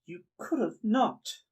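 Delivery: affronted, swooping high; indignant homeowner. Acted. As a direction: scold them in a high character voice. Game master voices indignant homeowner.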